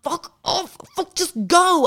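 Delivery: high, panicked swearing; urgent squeaky brush-off; frantic high shooing. Retold or imitated. To the French man, shooing him out in panic.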